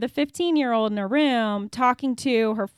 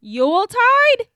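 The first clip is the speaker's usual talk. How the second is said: unsure guess; 11 semitones higher, 5 dB louder, slower than usual. Real, not acted.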